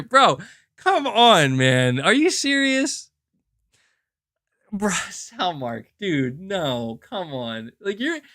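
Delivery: high, slow disbelieving groan; pleading, stunned disbelief; incredulous. Real, not acted. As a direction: drag it out like you can't believe it. Incredulous at viewer's comment.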